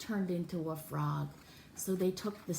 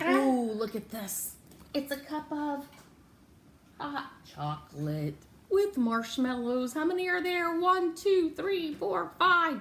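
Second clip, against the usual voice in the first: excited counting; 7 semitones higher, 6 dB louder than usual. Acted. Counting marshmallows with Nat, excited. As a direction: count each marshmallow with delight.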